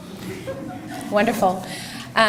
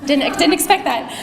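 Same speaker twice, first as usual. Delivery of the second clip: real catch of emotion, voice rising higher; choked up; 6 semitones higher than usual. Real, not acted. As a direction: caught off guard, close to tears. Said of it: "I'm gonna start crying".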